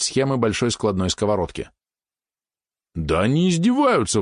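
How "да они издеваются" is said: high-pitched outraged protest; indignant. A narrator's reading. Valeron objects to the meagre loot, indignant. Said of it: "возмутился он".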